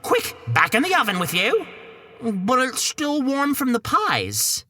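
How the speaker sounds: high, hurried Cockney housewife; high Cockney wife in a hurry. Acted. Wife hides Jack from her husband, hurried high Cockney. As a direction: hustle the boy into the oven in high Cockney.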